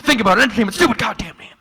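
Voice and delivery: high, loud insistent griping; worked-up rant. Retold or imitated. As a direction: hammer the point home, fed up. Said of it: "And he said".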